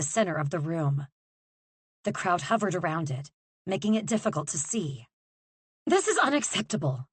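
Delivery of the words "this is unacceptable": clipped angry line, pitched higher; bit out. Acted. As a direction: bite off each word in anger. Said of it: "she bit out".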